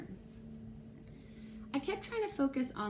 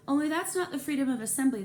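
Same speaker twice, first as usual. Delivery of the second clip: fast, louder, higher know-it-all correction; eager correction; 6 semitones higher, 6 dB louder, faster than usual. A narrator's reading. Narrator corrects Trey's drawing, quick and eager. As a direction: rush to set him straight.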